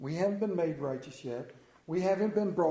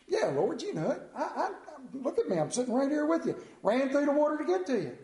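high, earnest protest; eager Peter; 7 semitones higher than usual. Acted. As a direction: insist with wounded eagerness. Peter eagerly answering Jesus.

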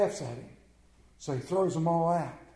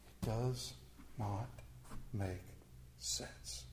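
hushed; 10 semitones lower, 19 dB quieter, slower than usual. Acted. Preacher hushing the congregation, near whisper. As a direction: drop almost to a whisper, much softer.

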